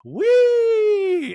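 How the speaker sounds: high, loud, held squeal; gleeful whee. Retold or imitated. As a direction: imitate leaping off with a long "whee". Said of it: "He's like".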